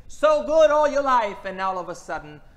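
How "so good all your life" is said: raised emphatic voice. Real, not acted. Preacher mocking self-assured believers.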